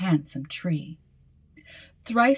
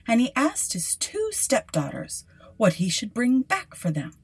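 plain reading voice

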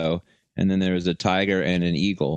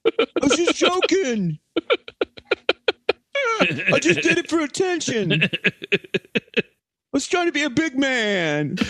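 much higher, pleading cowardly voice; whimpering Fauci. Pretend. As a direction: beg him to stop like a scared old man. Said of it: "Yeah, go".

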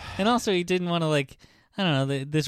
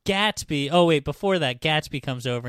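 ordinary talk, nothing marked

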